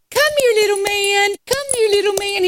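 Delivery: put-on high cooing baby talk; coaxing a puppy. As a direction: coo to a little dog you adore. Coaxing the dog in vision.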